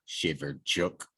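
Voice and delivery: plain reading voice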